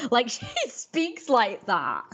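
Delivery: put-on high, slow, drawn-out Valley Girl; Valley Girl accent. Shown as a demonstration. Showing how the character speaks, exaggerated Valley Girl accent. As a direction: keep up the Valley Girl voice, laughing. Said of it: "He pretty much speaks like that in English".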